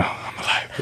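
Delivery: slow, hushed whisper just after waking. Retold or imitated. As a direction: breathe it out like you're peeking with one eye. Slowly opening an eye, joking.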